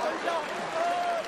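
high, fast theatrical wail; dramatic wail. Acted. Mother-in-law faking a heart attack to guilt her son-in-law, wailing. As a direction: wail as if your heart is failing.

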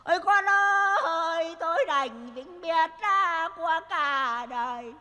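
hammed-up, drawn-out funeral lament; mock sobbing, sing-song cries of despair; loud wailing. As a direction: wail slowly on a high sustained chant. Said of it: "ốm gì mà hét to thế".

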